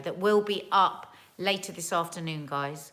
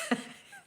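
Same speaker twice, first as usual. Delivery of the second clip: teary laugh; 3 dB quieter than usual. Real, not acted. Laughs through tears.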